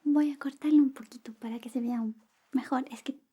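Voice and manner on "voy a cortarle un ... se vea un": whisper. Acted. ASMR whisper roleplay.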